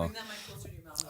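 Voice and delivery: quick unvoiced whisper; whispered aside. Real, not acted. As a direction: whisper it off to the side. Off-mic aside to guest.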